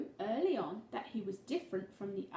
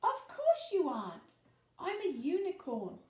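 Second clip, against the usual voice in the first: cheerful friends; 6 semitones higher than usual. A narrator's reading. Friends answer Kelp.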